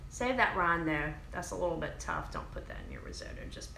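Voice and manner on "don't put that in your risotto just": whisper. Real, not acted. Aside tip about the rind.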